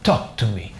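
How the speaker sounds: ordinary delivery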